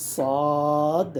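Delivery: সদ with a long, full vowel; held four alif long. Shown as a demonstration. Teacher reciting a heavy letter for beginners, long and full. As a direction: draw সদ out heavily. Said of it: "চার আলিফ".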